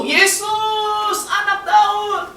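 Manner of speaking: high, long-drawn shout to Jesus; crying out. Acted. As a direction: call out pleading, stretching the names. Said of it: "mulailah ia berseru".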